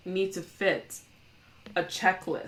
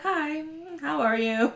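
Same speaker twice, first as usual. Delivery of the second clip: polite greeting; 10 semitones higher, slower than usual. Retold or imitated. Showing up polite, defying expectations.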